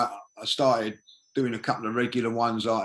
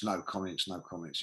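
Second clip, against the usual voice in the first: "no comments" dropped much softer and lower, dying away; fading to nothing; 4 semitones lower, 9 dB quieter than usual. Shown as a demonstration. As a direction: let "no comments" fade almost to silence. Mocking silence, fading out.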